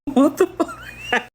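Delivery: slow, laugh-filled what the fuck; laughing disbelief. Real, not acted. After the clip, slow laughing disbelief. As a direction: drag it out through laughter. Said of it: "I can't stop laughing".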